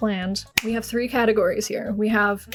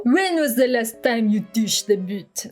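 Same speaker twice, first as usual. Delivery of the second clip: slow put-on French accent; slower than usual. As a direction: ask it slowly in mock French accent. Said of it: "I can do a French accent".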